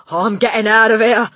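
high, loud, panicky announcement; frightened exclamation. A narrator's reading. Father about to flee, loud panic. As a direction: blurt it out in fright. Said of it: "greyer than ever now".

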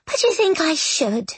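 put-on high, strained young ghost; tight ghostly voice. A narrator's reading. Ghost girl questioning Miss Eyre about leaving, voice tight. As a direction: ask it tightly, pitched well up. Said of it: "ghostly voice was tight".

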